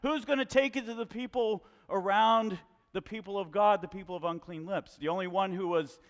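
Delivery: preaching. Acted. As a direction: preach it hard to the whole church. Preaching to the congregation.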